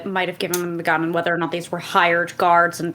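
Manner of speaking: ordinary talk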